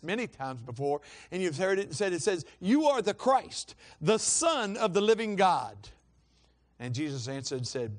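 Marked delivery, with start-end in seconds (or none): 2.6-5.8 louder, higher and drawn-out proclamation; emphatic declaration. Acted. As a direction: proclaim it slowly and boldly. Peter's declaration in scripture.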